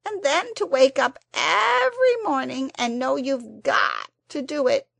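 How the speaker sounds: high, loud, tearful creature. A narrator's reading. The Psammead tearfully begs Anthea to spare it. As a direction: plead through tears of self-pity. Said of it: "it said in a tearful voice".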